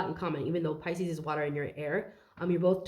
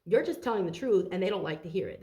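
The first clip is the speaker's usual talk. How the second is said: normal talking voice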